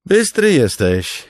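high, loud, slow smiling praise; said with a smile. Acted. Noro smiling at clever Kaladin, loud slow praise. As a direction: grin as you compliment him. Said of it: "powiedział porucznik Noro z uśmiechem".

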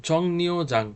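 "জংনিউজাং" with a clear dental n; correct pronunciation. Shown as a demonstration. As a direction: say "জংনিউজাং" with নি as in দন্ত ন. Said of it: "নয়ের মতো উচ্চারণ হবে".

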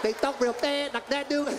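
mock Vietnamese-accented patter; imitation Vietnamese accent, words run together. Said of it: "You know they talk that fast".

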